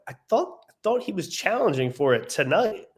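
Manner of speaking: high-pitched puzzled disbelief; confused. Retold or imitated. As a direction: recount it as baffled surprise, pitch jumping up at the start. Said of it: "that obviously was confusing to me".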